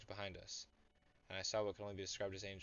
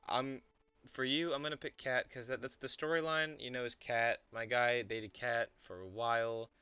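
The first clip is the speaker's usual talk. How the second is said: teasing excitement; 3 semitones higher, 10 dB louder, slower than usual. Real, not acted. Streamer teasing chatter Ramu.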